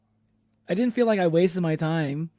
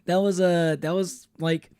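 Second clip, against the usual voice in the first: ordinary delivery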